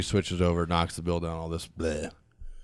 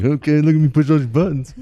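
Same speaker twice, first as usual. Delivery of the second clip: louder, higher mock cheer; sarcastic; 6 semitones higher, 7 dB louder than usual. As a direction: sarcastically announce each button press. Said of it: "I'm just like".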